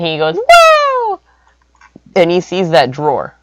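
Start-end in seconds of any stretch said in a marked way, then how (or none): 0.3-1.2 high, loud "woo"; high whoop. Acted. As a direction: let out a high-pitched whoop. Storyteller voices excited whoop.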